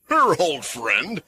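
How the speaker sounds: slow, mocking question. A narrator's reading. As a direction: scoff at the idea. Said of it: "the guard captain said mockingly".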